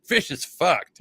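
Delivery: high, loud gloating with a laugh; gleeful ripping. Retold or imitated. Mocking Tanner's favourite band, loud gleeful gloat. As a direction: crow over a rival's bad song. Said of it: "being like".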